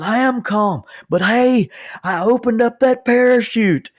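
high, loud yell claiming to be calm; imitated screaming. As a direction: holler it like an excited friend on the phone. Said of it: "I could hear him just screaming".

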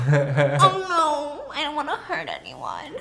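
mock first-person narrator. Host mocking the heroine's worry to her listener, mock helpless. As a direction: whine it with a laugh underneath. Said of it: "it's written in first person".

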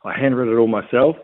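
ordinary delivery

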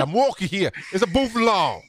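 badly faked Brooklyn accent. Shown as a demonstration. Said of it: "a Brooklyn accent on it".

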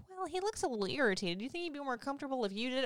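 high, soft, fast sweet-nurse voice; lightly sugary nurse impression; mimicking the nurse. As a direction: coo it like an overly helpful nurse. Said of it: "she's like".

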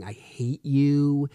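drawn-out mock yell; retold scream. Son yelling at his dad during pickup, mock scream. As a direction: imitate a child's scream at half force, words stretched. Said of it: "And he's screaming".

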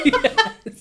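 high burst of laughter; laughing. Real, not acted. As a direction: laugh out the yes. Big laugh at late for work.

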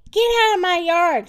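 loud, high storybook yell; angry shout. A narrator's reading. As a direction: yell to chase off an intruder. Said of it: "shouted Eugenia".

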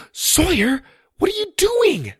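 high loud shocked cry; alarmed shout. Acted. Girl sees brother at globe.

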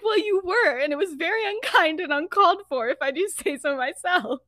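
high-pitched huffy reply; acted prim indignation; mock offended.

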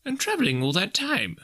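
high-pitched wondering; musing. A narrator's reading. Scrooge pondering the ghost's long travels, musing. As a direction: puzzle it out aloud. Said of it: "mused Scrooge".

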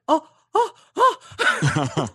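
loud gasped ohs; freaked-out gasps. Retold or imitated. Telling friends how she found the hashtag, freaked-out gasping. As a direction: re-enact a startled, excited gasping fit. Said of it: "I freaked out".

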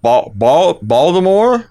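practising a Baltimore accent. Shown as a demonstration. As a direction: warm up with "Bal-, Bal-" and then stretch out "Baltimore" in a Baltimore accent. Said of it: "phone home kinda got a little bit of that Wayne".

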